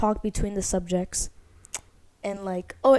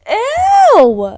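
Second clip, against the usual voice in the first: loud squeal; 15 dB louder than usual. Real, not acted. Reacting to pasta boy.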